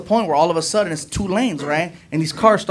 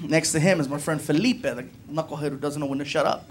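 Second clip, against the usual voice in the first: normal talking voice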